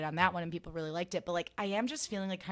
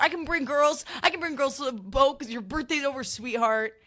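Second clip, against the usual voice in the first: much higher, much louder bragging taunt; Jax impression; 8 semitones higher, 9 dB louder than usual. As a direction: gloat loudly, pitched way up. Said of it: "He's like".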